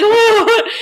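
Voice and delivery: high, loud laughing whoa; mock surprise. Laughing mock surprise while retelling the call. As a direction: blurt it out in a laughing mock gasp.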